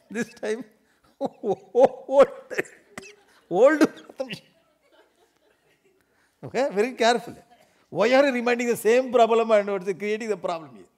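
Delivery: high, loud laughing complaint; high giggling protest. Acted. Wife protesting to husband, high loud giggling complaint. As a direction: squeal it through laughter.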